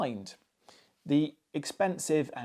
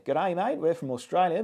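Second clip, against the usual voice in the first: put-on awful Australian accent; 2 semitones higher than usual. Reviewer jokes that the cables come from Australia, in a badly faked Australian accent. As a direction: toss off a cheesy Aussie greeting in a bad Australian accent. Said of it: "that's an awful Australian accent".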